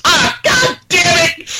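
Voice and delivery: high, slow mock yell of frustration; frustrated outburst. Retelling a friend's reaction to the announcement, high mock frustrated yell. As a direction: groan it out like your hope just died. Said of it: "he just goes".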